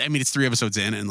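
ordinary talk

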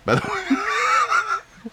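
high squealing laugh through the words; laughing shout. Real, not acted. Host cracking up at her own quiche joke, laughing shout. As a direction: crack up while saying it.

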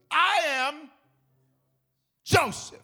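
loud, high proclamation with a big pause; dramatic reveal. Acted. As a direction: announce yourself, breaking before the name. Acts out Joseph's dramatic reveal.

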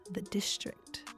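slow hushed whisper; whispered reveal. Acted. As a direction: breathe the name like a secret. Dramatic reveal of the venue.

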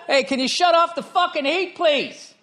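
loud, high call upstairs; yelled request to shut off the heat; yelling to another room. Real, not acted. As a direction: shout it so she hears from the next room. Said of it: "Don't stop screaming".

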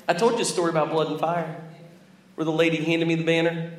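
ordinary talk

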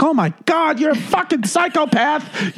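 high-pitched mock scream of outrage; screaming mom. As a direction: imitate Mom shrieking an accusation. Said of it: "my mom's screaming".

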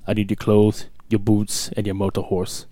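low, cold Terminator voice; Terminator impression. Low Terminator demand to campers for their clothes. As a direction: demand it like a killer robot. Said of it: "Terminator Edition".

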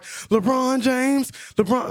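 high, slow drawn-out squeal; mock insisting woman. Narrator imitating the woman insisting he saw LeBron, high and slow. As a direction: stretch the name like she's sure.

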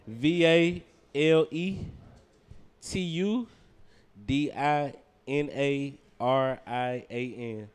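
slow letter by letter; spelling it out. Shown as a demonstration. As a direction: spell each letter slowly. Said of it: "go ahead and spell that for me".

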